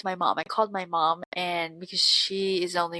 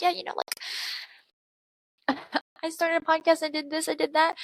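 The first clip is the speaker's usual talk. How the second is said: mock upbeat self; 8 semitones higher than usual. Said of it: "And then I was like".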